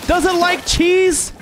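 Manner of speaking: mock shock. Reacting to the cheese fun fact.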